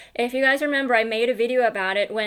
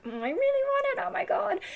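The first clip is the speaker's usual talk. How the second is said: much higher, eager dog voice begging for treats; voicing the dog. Owner voicing her dog begging for kangaroo treats, high and eager. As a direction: play the dog desperate for his treats.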